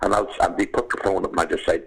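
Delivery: normal talking voice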